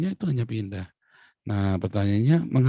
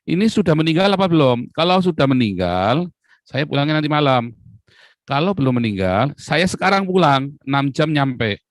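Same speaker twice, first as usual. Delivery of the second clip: loud, higher, firm demand; raised-voice bargaining on the phone; insistent retold reply; 5 semitones higher, 7 dB louder than usual. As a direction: insist on getting a straight answer. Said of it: "Saya bilang".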